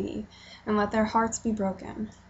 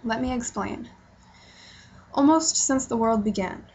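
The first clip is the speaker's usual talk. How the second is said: ordinary talk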